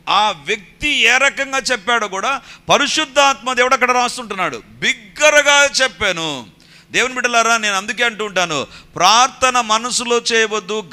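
high, loud, impassioned sermon delivery; full-force preaching. Real, not acted. Preacher urging believers to pray aloud from the heart, full force. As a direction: drive each point home to the congregation, loud.

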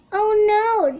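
a hint of Daddy panicking; Daddy's mock alarm. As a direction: play-act Daddy's worry. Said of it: "Daddy says".

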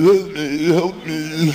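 slurred, high drawn-out plea; helpless sedated moan; drugged, can't talk. Retold or imitated. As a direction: plead like a sedated slug, words smeared. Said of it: "now I can't talk".

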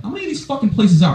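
high-pitched incredulous complaint; mock exasperation. Boyfriend on phone about the restaurants, mock exasperated. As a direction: push the question up in disbelief.

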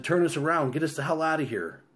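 pressing, high-pitched escape order; urgent order. A narrator's reading. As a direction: order them out in a hurry. Wife panics, husband orders escape.

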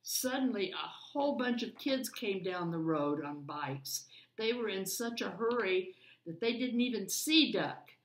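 put-on lively read-aloud for children; story narration. A narrator's reading. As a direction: read it like a bedtime picture book. Reads picture book aloud.

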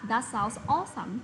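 slow, awesome stressed and falling. Shown as a demonstration. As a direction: press down on awesome. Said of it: "chúng ta nhấn vào đấy nhá".

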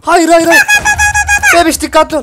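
startled high yell; panicked shouting. Real, not acted. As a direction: shriek in panic at the creeper. Creeper suddenly jumps in.

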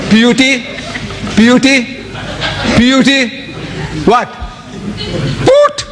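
spelling builds, then "Put!" pops up very high; mock baffled schoolboy, voice leaping on "Put!"; amused disbelief. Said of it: "that's funny".

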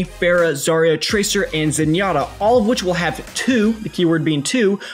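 ordinary delivery

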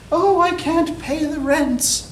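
high, slow, pleading damsel voice; damsel in distress. Acted. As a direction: play the helpless young heroine, high-pitched. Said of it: "a young heroine who is in some sort of trouble".

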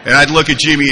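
usual speaking voice

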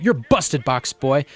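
confronting shout. Acted. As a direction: call him out, triumphant. Barry confronts Hector.

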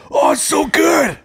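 high, slow hammed-up rapture; mock delight. Footballer tasting a baby Guinness, hammed-up high delight. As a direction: send up an American loving his drink. Acts American delighted by the shot.

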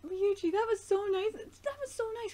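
high, gushing praise. Real, not acted. Fan gushing at the screen over Ryuichi, high. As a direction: coo it high with delight.

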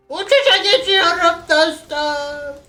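very high, slow, drawn-out call; high stretched catchphrase. Pretend. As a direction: stretch it high like a battle cry.

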